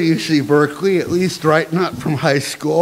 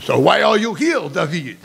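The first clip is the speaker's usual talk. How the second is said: lightly voiced stern French headmaster; a touch of sternness, French-accented. Headmaster asks student why he came, mildly stern. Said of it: "looked at me sternly and asked".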